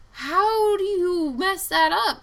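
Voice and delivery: high, loud and slow in disbelief; retold outrage. Venting at the trucking company about the wrong firing, exasperated and loud. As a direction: drag out each word, exasperated.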